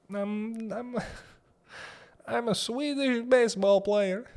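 high put-on voice with a laugh; Swedish player voice. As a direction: squeak it out like a goofy Swede.

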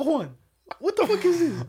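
high, loud indignant demand; shocked demand. Retold or imitated. Confronting Juan over the object, high indignant demand. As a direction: call Juan out, voice jumping up.

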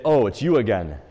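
loud, dismissive greeting to a familiar pest; dismissive acknowledgement. Acted. Saint answering the devil's attack, dismissive. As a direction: brush off a tiresome old visitor. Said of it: "Kind of almost like a dismissive acknowledgement".

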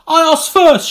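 high, loud indignant retort; petulant retort. Acted. Artist answers William, high loud indignant retort. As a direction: snap back that you asked first. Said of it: "she said".

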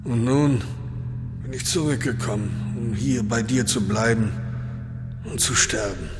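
put-on soft, high, weary old horse voice; tired old horse. Old horse telling its friend it will die. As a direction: speak as a horse come home to die.

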